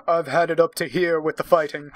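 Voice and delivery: a hint of fed-up Jack, pushed out loud; deep timbered voice. Husband tells wife he's done fighting, fed-up and loud. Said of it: "I'll try to put on a deep timbered voice when I read Jack".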